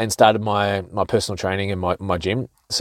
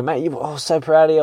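higher, lightly voiced proud mate; proud friend; 6 semitones higher than usual. Gym friends telling the boxer they're proud, higher gushing. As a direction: play a mate gushing with pride, pitched up. Friends praising him after fight.

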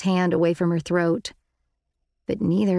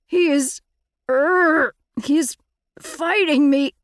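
strained; 8 semitones higher, 5 dB louder, slower than usual. Acted. Yeerk losing control of host.

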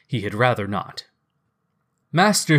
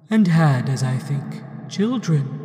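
ghost voice; 3 semitones higher than usual. Acted. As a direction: speak as a gentle ghost. Ghost character voice.

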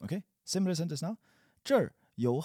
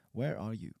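ordinary talk, nothing marked

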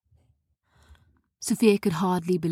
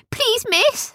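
high, tiny frightened squeak; frightened plea. Acted. Scared gnomes begging Sophia, high squeaky plea. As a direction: beg in a small high gnome voice. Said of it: "they said".

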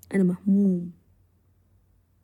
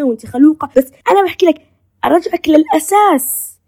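quick, louder, lively back-and-forth; animated retelling; 5 semitones higher, 8 dB louder than usual. Retold or imitated. Speaker replaying a talk with her friend, animated and fast. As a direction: rush the words like an eager chat with a friend.

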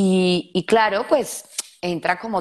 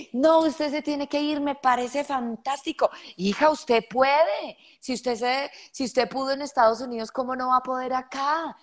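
encouraging parent; 6 semitones higher than usual. Retold or imitated.

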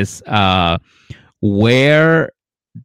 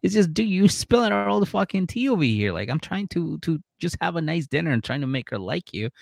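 exasperated friend; 6 semitones higher, 4 dB quieter than usual. Retold or imitated. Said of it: "And he's like".

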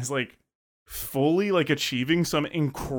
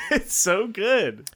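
real laugh through the words, higher and slow; gushing through a laugh, drawn out; 8 semitones higher, much slower than usual. Real, not acted. Laughing over a favourite line.

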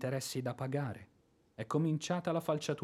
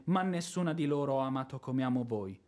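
ordinary talk, nothing marked

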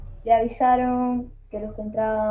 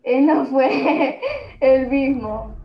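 slow laughing delivery; 3 semitones higher, 5 dB louder, much slower than usual. Pretend. Horror story climax, laughing.

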